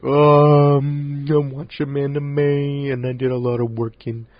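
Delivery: loud, drawn-out put-on voice; bad Brent impression. Host mimicking his absent co-host's monthly wrap-up, bad exaggerated impression. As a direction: stretch the words out in a badly faked imitation. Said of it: "I had a really bad Brent impression".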